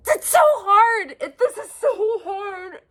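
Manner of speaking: words breaking into sobs, high and loud; tearful, overwhelmed despair; real crying. Real, not acted. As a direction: cry it out, voice cracking. Breaks down crying about struggle.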